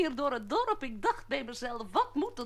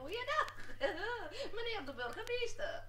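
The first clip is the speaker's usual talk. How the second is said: giggly clown; 7 semitones higher, 9 dB quieter than usual. Acted. Clown greets the mayor.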